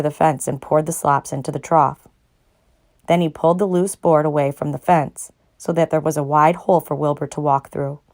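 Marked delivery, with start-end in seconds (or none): none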